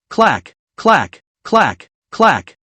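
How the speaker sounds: voiced crunch-crunch chewing noise; playful munching sounds. A narrator's reading. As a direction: mimic the crunch of a snack. Munching snow cake noisily.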